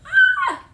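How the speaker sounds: high laughing squeal of surprise; excited shriek. Real, not acted. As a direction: squeal with delight. Sees forecast of snow.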